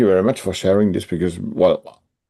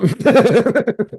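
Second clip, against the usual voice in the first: laughing; 4 dB louder than usual. Real, not acted. Laughing at wife joke.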